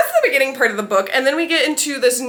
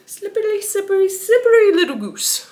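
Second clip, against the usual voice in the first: much higher, silly teasing repeat; playful high voice; 8 semitones higher, 3 dB louder than usual. Acted. Repeating the hero's taunt for fun, playful high voice. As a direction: pitch it up high and tease.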